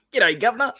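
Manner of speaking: high-pitched mock Australian greeting; mock Australian accent. Riffing back on the British street joke, mock Australian accent. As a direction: answer with a high, hammed-up Australian twist. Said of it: "like, Australian T V shows".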